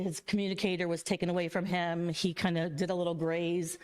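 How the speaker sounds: usual speaking voice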